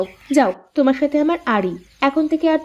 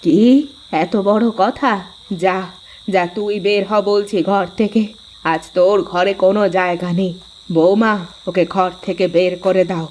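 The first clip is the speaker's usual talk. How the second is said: put-on lower, older mother ordering her son out; mother-in-law taking her daughter-in-law's side; scolding mother-in-law; 6 semitones lower than usual. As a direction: scold him as his stern mother.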